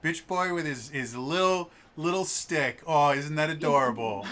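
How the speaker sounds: mocking taunt. Spitwit taunts Smegwick about his little stick, mocking coo. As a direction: belittle him with fake admiration. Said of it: "I could see him, like, putting little images".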